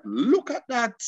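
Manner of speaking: high, delighted marvel; admiring exclamation. Retold or imitated. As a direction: exclaim in impressed wonder. Admiring reaction retold.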